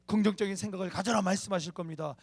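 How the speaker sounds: loud, building preacher's exhortation; fervent preaching. Real, not acted. Preaches at full force.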